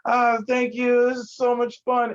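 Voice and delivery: high, slow, loud tipsy gushing; imitating a drunk girlfriend. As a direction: gush like a happy drunk girl. Drunk girlfriend voiced in story.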